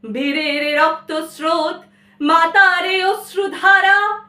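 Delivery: loud, high declaimed verse, vowels drawn out; declamatory recitation. Acted. Child reciting for artist mother, ringing declamation. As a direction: declaim it in full voice. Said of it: "আবৃত্তি করতাম".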